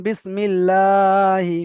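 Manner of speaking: বিসমিল্লাহি with the "laa" held long and level; drawn-out madd. Shown as a demonstration. Teacher shows the class the madd, long-held "লা" in বিসমিল্লাহি. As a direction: stretch the "লা" in বিসমিল্লাহি, then a short "হি". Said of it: "এক আলিফ পরিমাণ টান দিয়ে পড়তে হবে".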